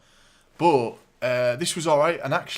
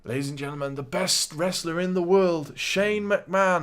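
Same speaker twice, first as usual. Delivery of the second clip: announcer voice; 6 semitones higher, 3 dB louder than usual. Retold or imitated. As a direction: lift it into a proper ring intro. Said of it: "And he goes".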